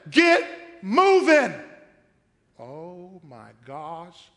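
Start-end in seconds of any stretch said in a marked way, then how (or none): 0.1-1.5 loud, slow, high order; impatient command. Retold or imitated. God ordering Moses forward, loud and slow. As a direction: push him out the door. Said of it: "He says".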